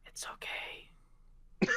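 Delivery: slow soothing whisper; calm them down softly. Acted.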